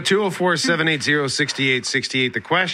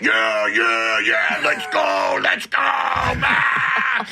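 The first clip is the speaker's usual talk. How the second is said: mock celebration yell; 2 semitones lower, much slower than usual. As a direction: ham up a teen golfer's victory whoop. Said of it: "They're like".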